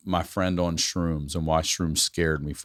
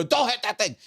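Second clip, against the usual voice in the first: higher put-on voice of a dazed cousin; mock cousin voice. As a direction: squeak it like the woozy cousin.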